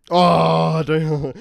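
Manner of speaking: loud, drawn-out protesting wail; pained outcry. Real, not acted. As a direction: cry out at a sore memory. Teased about a painful loss.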